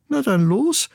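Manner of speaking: high chick voice egging someone on; daring challenge. Acted. Sister chick tells boastful brother to jump, challenging. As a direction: call the braggart's bluff.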